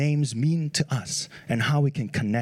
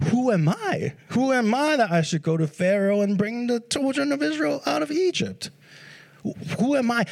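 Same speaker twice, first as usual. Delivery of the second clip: higher-pitched, mildly anxious self-doubt; Moses, doubtful; 6 semitones higher than usual. Acted. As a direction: protest your unworthiness, pitch lifted. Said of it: "because he was afraid. He was doubtful".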